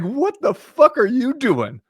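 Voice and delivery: high, slow, disbelieving voice; incredulous waiter. Retold or imitated. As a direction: stretch it out like you can't believe what you heard.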